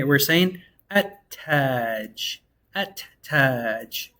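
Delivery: at-taj drawn out slowly, double T, no L. Shown as a demonstration. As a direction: stretch at-taj, swallow the lam. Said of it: "We say".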